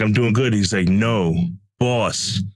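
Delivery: normal talking voice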